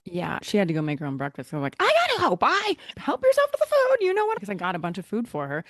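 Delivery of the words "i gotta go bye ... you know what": high, loud, hurried send-off; rushed goodbye. Retold or imitated. Hurrying past a houseguest to a meeting, a high loud rushed goodbye. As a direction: toss it over your shoulder on the way out.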